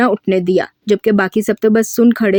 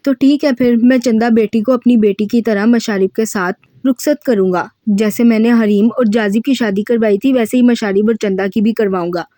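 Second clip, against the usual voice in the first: ordinary delivery, nothing marked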